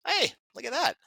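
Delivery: ordinary talk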